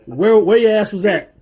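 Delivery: loud, high, confrontational; mock challenge. Podcaster mocking new anime fans, confrontational. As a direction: call out the latecomers.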